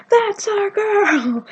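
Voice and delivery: high, very drawn-out cheer; mock scream. Fans repeat the chant to Colleen, slow mock scream. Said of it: "we were just screaming at her".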